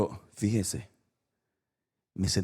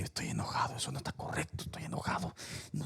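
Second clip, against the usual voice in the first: whispered fuming to himself; seething quietly in the truck; angry whisper; 11 dB quieter than usual. Retold or imitated. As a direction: hiss it under the breath, seething. Retold muttering anger to himself.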